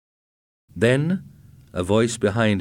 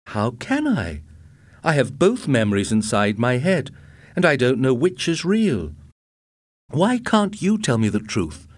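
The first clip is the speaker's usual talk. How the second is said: raised-pitch, agitated appeal; distressed pleading; 5 semitones higher, 3 dB louder than usual. A narrator's reading. Husband with two memories begs wife for truth, distressed. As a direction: plead helplessly, voice climbing.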